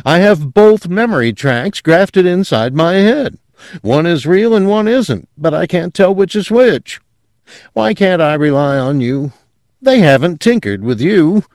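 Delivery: high-pitched, agitated insistence; agitated pleading. A narrator's reading. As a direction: plead your confusion, pitched well up. Husband pleads his confusion to wife.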